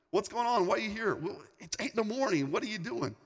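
high, light baffled questions; puzzled questioning. Retold or imitated. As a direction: question a friend in retold bafflement. Retold puzzled questioning of early visitor.

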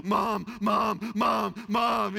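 loud, high repeated cries for Mom; mock screaming. Dad imitating his son on the ride, mock panicked yelling. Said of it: "he was just screaming".

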